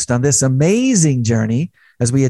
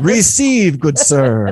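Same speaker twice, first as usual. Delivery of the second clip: mock server call; 3 semitones higher, 5 dB louder, much slower than usual. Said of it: "Doesn't that just sound fun".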